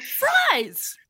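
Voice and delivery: high-pitched mock cry; drawn-out falsetto-like mimicry; imitated exclamation. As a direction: squeal it, imitating a friend's outburst. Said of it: "You went".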